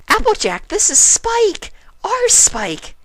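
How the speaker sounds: very high, loud, slow insistent squeal; exasperated Pinkie. A narrator's reading. Pinkie telling Applejack it's Spike, exasperated squeal. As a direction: spell it out for her, exasperated.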